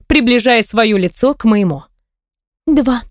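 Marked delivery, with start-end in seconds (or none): none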